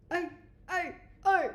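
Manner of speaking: a light, joking singer impression. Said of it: "my [name] imitation".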